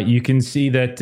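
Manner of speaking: ordinary talk, nothing marked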